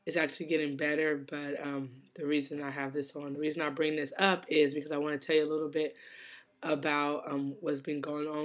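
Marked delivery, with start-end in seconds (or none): none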